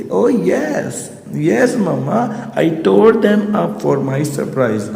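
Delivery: put-on high, eager child voice; a small girl chirping proudly; high piping little Kezia. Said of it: "Kezia बोलती".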